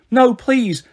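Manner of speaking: put-on high-pitched childish plea; begging. A narrator's reading. Oliver begs to keep his tattoos, pleading. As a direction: plead like a boy desperate to keep something. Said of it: "he begged".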